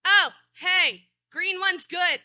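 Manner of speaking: loud, high announcement; calling out. Real, not acted. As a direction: call it out to the whole room. Calls out on finding recording sign.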